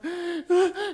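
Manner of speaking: loud, high gasping sobs; imitated child's sobbing. Boy too upset to speak to mother, mock gasping sobs. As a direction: sob too hard to get words out. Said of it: "이러면서 막 울고 있으니까".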